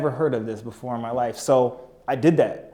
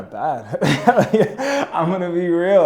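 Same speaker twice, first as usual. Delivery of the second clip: laughing; 4 semitones higher, 5 dB louder, much slower than usual. Real, not acted. Laughing while confessing.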